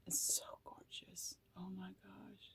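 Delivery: breathless whisper of wonder; awed whisper. Real, not acted. Tarot reader whispering over a beautiful card. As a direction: whisper it, overwhelmed.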